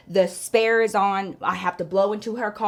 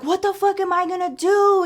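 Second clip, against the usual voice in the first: high-pitched panicked wail, acted out; retold freak-out; 10 semitones higher, 3 dB louder than usual. Broke friend with a flat tire, imitated panic. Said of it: "starts freaking out".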